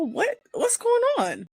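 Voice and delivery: high-pitched, incredulous yelp; acted bewilderment, pitch jumping up; baffled outcry. Acts out her baffled reaction.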